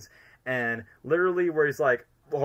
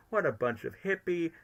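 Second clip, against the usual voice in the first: dropping, softer villain scoff; Lord Business; 3 semitones lower, 5 dB quieter than usual. Retold or imitated. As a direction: scoff it in a lightly put-on villain voice. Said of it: "what Will Ferrell's Lord Business said".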